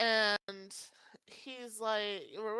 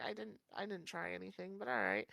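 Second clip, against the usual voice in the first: deadpan; 3 semitones lower, 9 dB quieter than usual. Retold or imitated. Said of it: "and I'm like".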